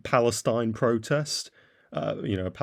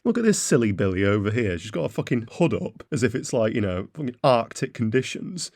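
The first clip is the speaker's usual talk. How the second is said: ordinary delivery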